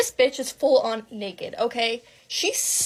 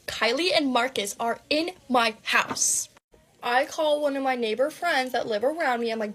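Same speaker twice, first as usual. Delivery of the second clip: plain reading voice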